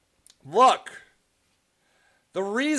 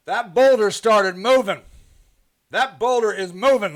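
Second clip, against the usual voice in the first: usual speaking voice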